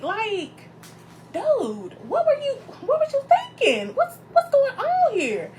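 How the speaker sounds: high, incredulous demand; high, slowed what-were-you-thinking to boyfriend; exasperated call-out. Retold or imitated. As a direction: let him have it, disbelieving. Confronting boyfriend over birthday.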